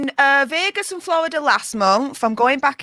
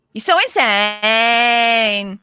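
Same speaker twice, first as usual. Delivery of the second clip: put-on whiny drawn-out Valley Girl endings; 3 semitones lower, much slower than usual. Shown as a demonstration. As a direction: drag the last word into a long whine. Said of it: "whiny kind of at the end".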